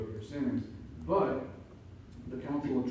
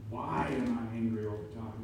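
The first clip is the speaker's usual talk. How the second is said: ordinary talk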